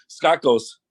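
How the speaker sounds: usual speaking voice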